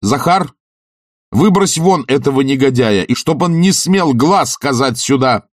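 high, angry commanding shout; shouted order. A narrator's reading. Oblomov orders Zakhar to eject Tarantyev, shouting. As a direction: summon the servant and order him out. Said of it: "закричал Обломов".

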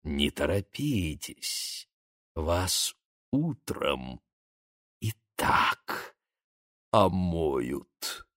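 low slow breathy threat; menacing whisper. Acted. Vampire menace continued.

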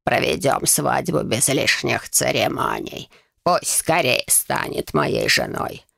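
put-on low, slow old man voice; a hint of a creepy old man. A narrator's reading. Old Conrad speaks.

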